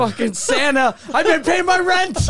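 high, loud indignant yell; hammed-up furious tenant; mock shout. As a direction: yell it at Santa like a wronged tenant. Mock tenant yelling at Santa.

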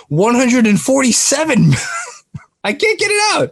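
breaking into laughter over the number; can't get the figure out for laughing; high squeaky laugh through the words; laughing disbelief. Real, not acted. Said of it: "I can't even say this with a straight face".

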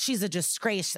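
ordinary talk, nothing marked

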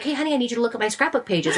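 fast eager request; wife excited after Scrapbook Weekend, fast; eager rush. Retold or imitated. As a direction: rattle it off excitedly. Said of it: "I'm like".

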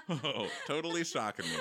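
voicing the victim through laughter; fast, giggly mock quote; mock victim, laughing. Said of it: "He's like".

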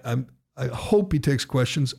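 usual speaking voice

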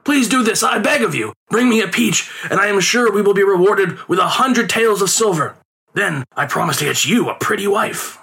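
lightly voiced pleading father; father pleads. A narrator's reading. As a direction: beg the boy to cooperate. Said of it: "his father pleaded".

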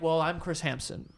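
slow, soft, lightly voiced host; Chris Hansen impression. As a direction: ease into a mild deadpan host intro. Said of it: "and they're like".

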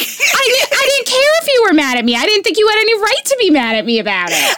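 high, fast indignant protest; insist, rising in pitch, fast; defending herself to her cohost, high and fast; worked-up protest. Real, not acted. Heated self-defence, voice high.

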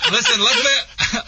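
real laughter cracking the words, pitch jumping high; laughing. Real, not acted. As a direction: tries to start the sentence but laughs through it. Breaks up laughing mid-sentence.

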